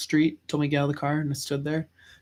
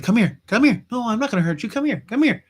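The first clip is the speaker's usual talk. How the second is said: high, insistent mock coaxing; imitated chasing mom; 8 semitones higher, 6 dB louder than usual. Angry mom chases child round kitchen island, mock coaxing. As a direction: lure them back with raised, urgent calls. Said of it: "she was like".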